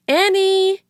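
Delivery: high, loud call across a room. A narrator's reading. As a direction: shout her name to find her. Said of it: "he called".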